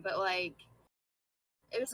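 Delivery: normal talking voice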